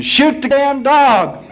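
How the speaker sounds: high, drawn-out, very loud yell; screaming. Acted. Falling catcher yelling to the gunman, acted scream. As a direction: scream it out slowly at full volume. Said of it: "he's screaming".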